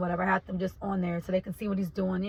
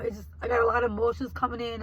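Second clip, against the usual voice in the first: usual speaking voice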